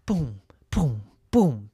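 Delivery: ordinary delivery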